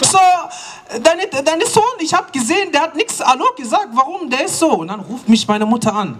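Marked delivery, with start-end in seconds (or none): 0.0-4.7 put-on high accented auntie voice; gossiping auntie. Auntie snitches to the mother about her son. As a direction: tell on the boy like a nosy aunt.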